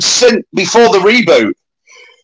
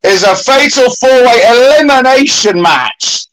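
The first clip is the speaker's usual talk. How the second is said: ringmaster; 4 semitones higher than usual. Acted. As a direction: call it out like a circus ringmaster. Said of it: "I'm gonna let you introduce".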